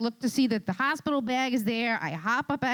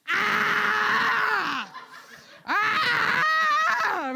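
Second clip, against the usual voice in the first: imitated labor scream; 8 semitones higher than usual. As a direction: belt out a hammed-up birth scream. Wife screaming in triage.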